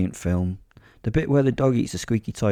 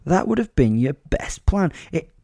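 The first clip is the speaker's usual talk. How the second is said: grand theatrical lecture, pitched up and emphatic; monologuing; 3 semitones higher, 4 dB louder than usual. Acted. Fighter giving beaten attackers sound advice, monologuing. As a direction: lecture them like a self-styled hero. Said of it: "I monologued at them now".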